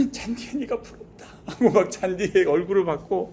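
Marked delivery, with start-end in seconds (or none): 0.1-1.2 breathless whisper of envy; whispered lament. Retold or imitated. 잔디를 붙잡고 우는 남자, 속삭이듯 흐느끼며. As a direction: whisper it like a broken man. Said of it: "기어가서 잔디를 붙잡고".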